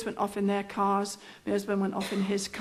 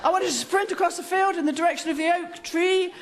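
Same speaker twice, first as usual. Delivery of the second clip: higher-pitched, hammed-up instructor; bossy trainer instructions; 5 semitones higher than usual. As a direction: rattle off orders like a drill instructor. Trainer gives long instructions.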